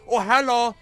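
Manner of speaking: high, loud, excited fake German accent; German boy voice. Gamer switches on his German take, high hammed-up German accent. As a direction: ham up a cheery little German boy in a thick German accent. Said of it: "Turn it, turn on German take".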